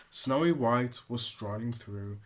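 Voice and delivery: usual speaking voice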